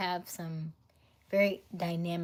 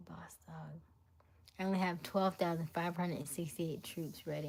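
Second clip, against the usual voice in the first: usual speaking voice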